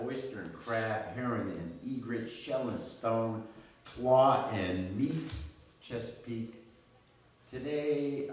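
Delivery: lyrical reading, swelling on openings and dropping away at line ends; poem recitation. Acted. Poet addresses the Chesapeake Bay before a live audience, lyrical recitation. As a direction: recite it as an ode, with deliberate pauses.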